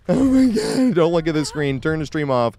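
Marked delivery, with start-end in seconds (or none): 0.1-0.9 embarrassed. Real, not acted. Streamer embarrassed.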